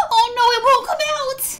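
high shrill gasp, then cry; panicked gasp. Acted. Hair still on fire.

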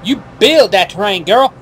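hammed-up sassy voice; sassy excited. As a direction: camp it up with gusto. Playful sassy announcement.

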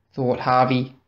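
ordinary delivery

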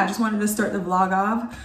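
normal talking voice

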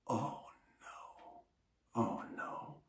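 whispered, dawning dread. Retold or imitated. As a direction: breathe out the panic under your breath. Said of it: "I'm like".

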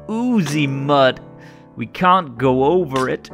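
plain reading voice

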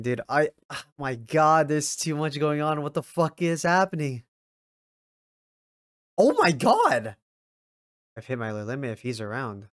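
usual speaking voice

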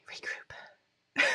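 soft whisper to herself; whispered to self. Real, not acted. Flustered host telling herself to refocus, in a whisper. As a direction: whisper a reminder to yourself. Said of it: "calming herself mid-stream".

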